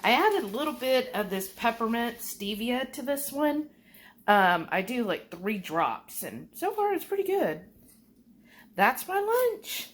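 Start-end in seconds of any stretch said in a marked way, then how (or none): none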